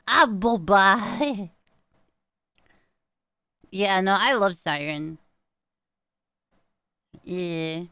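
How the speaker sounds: ordinary delivery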